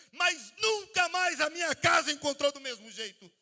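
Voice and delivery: crescendo até gritar, bem mais agudo e alto; pregação inflamada. Acted. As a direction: pregue com fervor crescente até o grito final. (pregação ardente que cresce até o grito)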